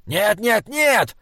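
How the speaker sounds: high, impatient bark; barked refusal. A narrator's reading. Old jester snaps at the troll, barking. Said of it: "рявкнул Таузер".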